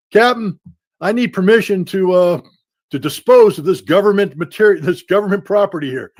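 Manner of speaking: hammed-up timid private begging his captain; mock private asking captain. Private asks captain permission, mock timid plea. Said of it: "having a Russian private going".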